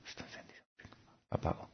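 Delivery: near-whispered aside to oneself; hushed reenactment. Retold or imitated. Speaker finds the heater left on, hushed reenactment. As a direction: murmur it under your breath, acting it out.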